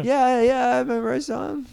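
very high imitation of a squirming teen; nervous agreement. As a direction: squeak a hasty yes. Cornered teen answers daughter.

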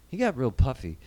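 normal talking voice

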